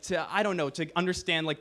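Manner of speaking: ordinary talk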